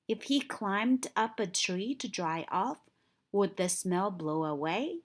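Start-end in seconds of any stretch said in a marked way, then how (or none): none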